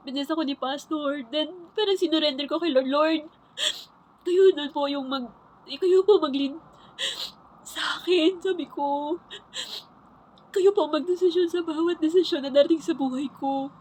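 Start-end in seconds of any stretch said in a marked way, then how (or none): 2.9-13.7 higher, crying, handing it over to God; tearful surrender. Real, not acted. Surrendering her life to God, tearful. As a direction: pray through tears, giving up control. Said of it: "umiyak ako".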